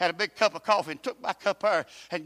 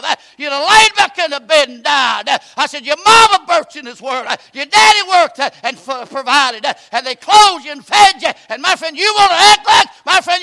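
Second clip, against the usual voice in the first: loud, high preacher climbing to a shout; full-force preaching; 9 semitones higher, 17 dB louder than usual. Acted. Preacher urging the congregation, loud and rising. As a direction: drive it home from the pulpit.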